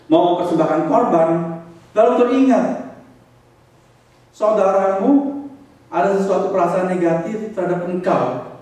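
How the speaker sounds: loud, high, impassioned sermon; fervent pulpit delivery; full-force preaching. Acted. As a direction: press the command on the congregation. Preacher quoting Jesus, exhorting.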